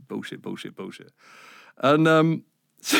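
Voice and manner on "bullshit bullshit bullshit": low, quiet muttered bullshit; muttered aside. Real, not acted. Telling a podcast host, muttered aside. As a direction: mumble it under the breath, self-mocking. Mumbled aside after boasting.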